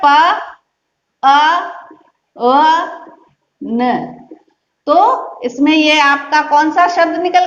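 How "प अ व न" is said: प, अ, व, न each sounded out separately with long gaps; syllable by syllable. Shown as a demonstration. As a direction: sound out प अ व न one at a time. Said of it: "कैसे बोलोगे".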